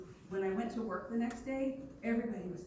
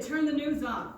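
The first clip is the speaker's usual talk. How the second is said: louder, firmer command to turn it off; firm big-brother order; 5 semitones higher, 7 dB louder than usual. Retold or imitated. Brother firmly ordering his scared sister, a louder command. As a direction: say it like a big brother laying down the law. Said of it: "he said it like that".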